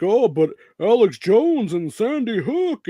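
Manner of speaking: high, whiny mock voice of a nagging reporter; high mocking whine, slow and drawn out. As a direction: sneer it like a pestering journalist. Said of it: "And they're like".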